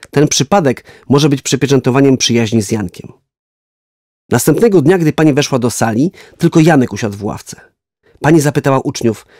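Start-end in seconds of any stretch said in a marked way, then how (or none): none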